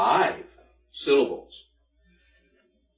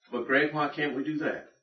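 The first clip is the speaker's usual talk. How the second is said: usual speaking voice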